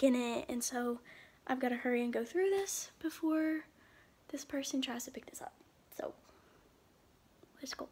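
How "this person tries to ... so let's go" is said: unvoiced hush; whispering. Real, not acted. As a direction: whisper it so nobody hears. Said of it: "hope none of you are wearing headphones".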